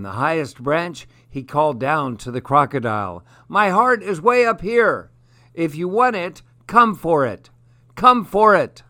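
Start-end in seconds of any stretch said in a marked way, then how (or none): 3.5-6.4 high, loud call from up in the tree. Acted. Monkey calling down to the crocodile from the treetop. Monkey taunts from highest branch. 6.7-7.5 loud, higher teasing call; taunting. Acted. Monkey daring the crocodile from the branch, teasing. 7.9-8.8 "Come for it" shouted much higher and louder, mocking; louder taunt. Monkey mocking the stranded crocodile, louder taunt.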